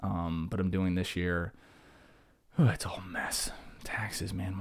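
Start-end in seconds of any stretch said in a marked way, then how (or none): 2.6-4.6 whispered, drained groan over taxes; exasperated whisper. Real, not acted. Teacher whispering to listeners about the tax mess, exasperated. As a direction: breathe it out, defeated.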